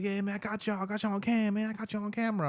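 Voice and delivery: high mock accuser voice; retold older dude. Older dude claiming he filmed the two brothers, high-pitched imitation. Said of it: "he's like".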